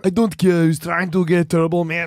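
put-on thick Austrian-accented action-hero dad; Arnold Schwarzenegger. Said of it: "I'm the character from Jingle All the Way".

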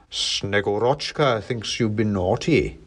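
slow, weighty Santa voice; Father Christmas. A narrator's reading. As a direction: Santa passing measured judgement. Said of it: "said Father Christmas".

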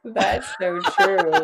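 laughing. Real, not acted. Laughing at the joke.

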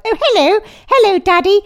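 high, loud posh "hello, Daddy"; posh child. Acted. Friend imitates the baby greeting Daddy, mock posh. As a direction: put on a posh little kid greeting Daddy. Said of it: "He's gonna be really posh".

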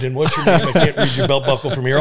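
loud, heckling voice; loud heckler. Retold or imitated. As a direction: yell it across the bar like a heckler. Retold heckler lines.

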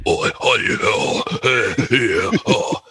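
blustering British "oi" noises; British guttural grunts. Shown as a demonstration. Showing favourite British voice, wordless guttural bluster. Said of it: "British guys that just go like".

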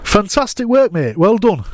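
cheery broad Yorkshire praise; Yorkshire accent. Shown as a demonstration. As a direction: beam it out like a Leeds manager. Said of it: "So much better in Leeds".

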